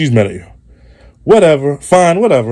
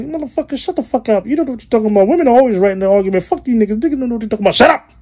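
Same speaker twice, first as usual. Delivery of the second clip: much higher mock woman's voice; angry woman mocked; 11 semitones higher, faster than usual. Comic mimicking a woman scolding men, high mock voice. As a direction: squeak it out like a fed-up girlfriend telling him off. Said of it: "Ladies, don't get mad".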